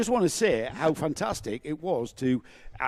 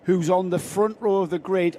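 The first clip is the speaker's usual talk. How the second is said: race commentary; 4 semitones higher, 5 dB louder than usual. Acted.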